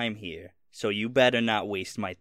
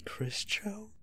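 soft, higher whisper; whispered prompt; 11 semitones higher, 9 dB quieter than usual. Real, not acted. GM whispering the forgotten name to the player, hushed. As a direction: slip him the name quietly. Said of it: "prompting in a whisper".

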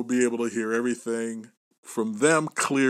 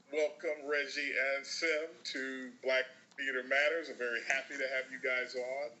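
distant tinny line; like talking through a tin can far away; tin can phone; 11 dB quieter than usual. Real, not acted. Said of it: "I sound like I'm talking through a tin can about thirty feet away".

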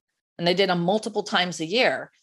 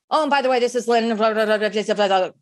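hurried salesperson mumbling who she is; rushed voicemail sign-off; 2 semitones higher, faster than usual. Retold or imitated. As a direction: blurt the name out and race through it. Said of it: "gave that phone number and who they are so fast".

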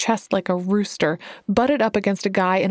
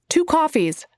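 much higher, curt, irritated order; snapped order; 8 semitones higher than usual. A narrator's reading. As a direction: bite off the order to a rude waiter. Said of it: "she snapped".

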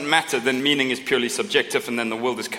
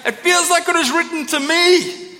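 gushing fan; 4 dB louder than usual. Retold or imitated. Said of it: "one of the things we heard ... is like".

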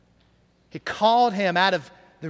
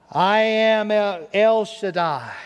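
slow, weighty "El Shaddai"; solemn; slower than usual. Acted. God declaring himself to Abram, slow and solemn. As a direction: draw out "I am El, El Shaddai" majestically. God's words quoted slowly.

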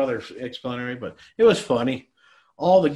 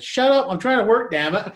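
higher, louder retold snap; mock-irritated, raise your voice at an interrupter; exasperated outburst; 9 semitones higher, 6 dB louder than usual. Worker to the guy asking questions while filming, exasperated.

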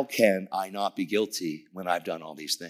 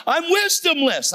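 high, louder celebratory cry; retold screaming; 5 dB louder, slower than usual. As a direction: holler it out high and drawn out. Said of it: "I'm screaming in the prayer room".

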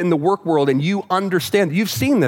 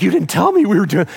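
mock panic; 2 semitones higher, 4 dB louder than usual. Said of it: "like she was freaking out".

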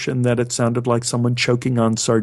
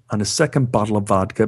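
usual speaking voice

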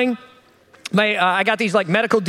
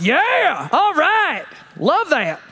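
put-on drawled, approving good old boy; 5 semitones higher, much slower than usual. Passing drivers cheering the Camry, mild good old boy approval. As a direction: play the nodding country guy. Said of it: "all the good old boys are like".

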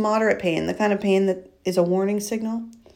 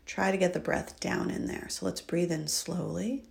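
soft, hushed breathing guidance; soft spa whisper; 6 dB quieter than usual. Acted. Leads a breathing exercise.

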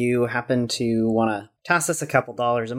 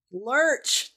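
excited; 12 semitones higher than usual. Real, not acted. Delighted by the pun.

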